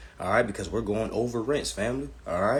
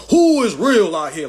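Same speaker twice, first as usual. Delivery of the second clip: mocking quote; 8 semitones higher, 9 dB louder than usual. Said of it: "tagged all of us like we was in a bunch saying".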